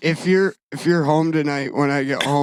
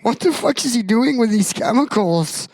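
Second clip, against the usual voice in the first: higher mock outrage, slowing at the end; imagined suspicious coworkers; 7 semitones higher than usual. Coworkers questioning his chemical use, higher mock outrage. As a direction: play the baffled boss, hammed up. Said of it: "they're gonna start to question".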